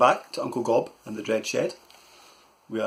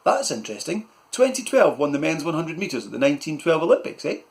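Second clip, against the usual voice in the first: put-on higher innocent boy; boy's voice; 5 semitones higher, 4 dB louder than usual. Malcolm answering his uncle, higher. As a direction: play dumb sweetly.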